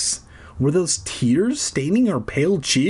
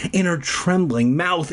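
usual speaking voice

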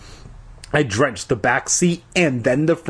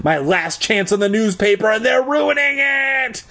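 outraged wail; 9 semitones higher than usual. A narrator's reading. Boy whose last chance is being ruined, outraged wail. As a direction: build to a high, screamed last word. Frantic thought building to a wail.

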